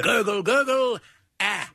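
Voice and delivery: high-pitched comic gurgle, then a feeble expiry; mock drowning boy. Drowning boy cutting into the story, mock gurgling. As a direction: speak the gurgles as a badly faked drowning. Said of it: "the boy said".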